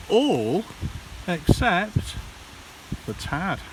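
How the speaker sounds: ordinary delivery, nothing marked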